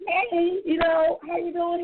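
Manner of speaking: high, sing-song fake-casual hello; mock cheery greeting. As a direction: play it breezy to cover the embarrassment. Acting out her own awkward greeting.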